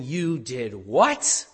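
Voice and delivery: normal talking voice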